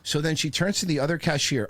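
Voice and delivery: ordinary talk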